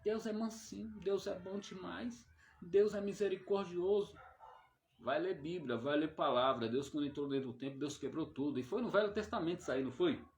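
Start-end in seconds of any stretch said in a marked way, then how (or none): 0.1-4.2 mocking naive believers. Preacher mocks those who say God is gentle, sing-song mimicry. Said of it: "tem pessoa que fala assim".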